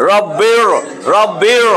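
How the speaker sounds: রব্বির with a thick, heavy r. Shown as a demonstration. Teacher showing the student a heavy r in রব্বির. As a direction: stretch রব্বির, r sounded full and heavy. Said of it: "রটা মোটা করে পড়বেন".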